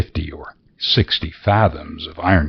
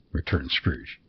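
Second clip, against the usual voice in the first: ordinary delivery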